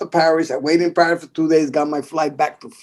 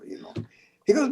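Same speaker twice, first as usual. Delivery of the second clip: ordinary talk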